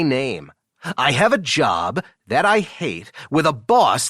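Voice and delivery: lightly performed, lively read-out with sweeping tune; friend reading a personal ad. Acted. Friend reads an employee's billionaire-seeking post to the boss, lightly theatrical. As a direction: read it out with a hint of the sassy writer.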